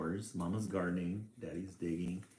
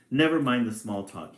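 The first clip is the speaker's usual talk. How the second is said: mild growl; 2 semitones higher, 8 dB louder than usual. A narrator's reading. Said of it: "he growled".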